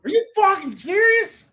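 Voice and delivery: can't believe what he just heard; real shock at a crazy pick; stunned disbelief. Real, not acted.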